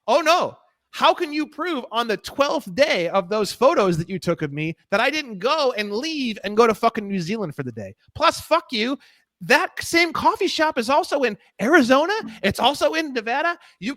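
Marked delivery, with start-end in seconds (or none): none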